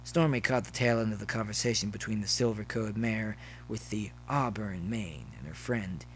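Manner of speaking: usual speaking voice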